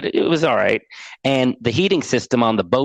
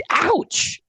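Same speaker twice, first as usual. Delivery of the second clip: hurt ouch. Retold or imitated. Stung by father's reply.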